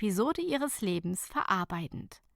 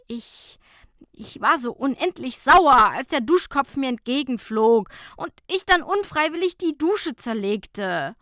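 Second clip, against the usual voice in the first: sighing confession; 5 semitones higher, 7 dB louder than usual. A narrator's reading. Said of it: "seufzte Fabienne".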